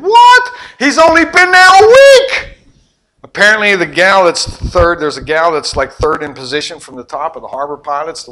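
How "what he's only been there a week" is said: high, loud disbelieving cry; astonished. Retold or imitated. Father reacting to his son's news, astonished. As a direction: shout it in happy shock.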